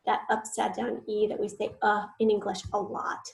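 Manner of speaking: usual speaking voice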